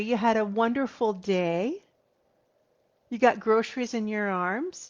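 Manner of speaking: normal talking voice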